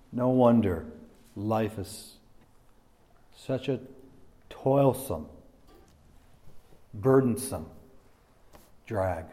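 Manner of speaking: soft, halting weariness with long pauses; weary lament. Acted. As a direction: let each word drop heavily and quietly. Drawn-out lament over joyless life.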